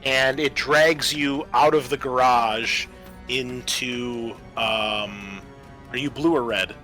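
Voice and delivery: normal talking voice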